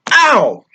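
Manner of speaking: hammed-up pained ow; mock cry of pain. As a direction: yelp high and loud as if punched. Pretends to be punched.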